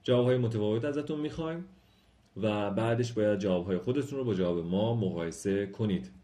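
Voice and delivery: ordinary delivery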